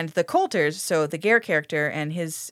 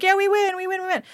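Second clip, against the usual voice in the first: high-pitched giddy cheer; 12 semitones higher than usual. Retold or imitated. Gare and mom celebrating their win, squealing high cheers. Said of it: "being like".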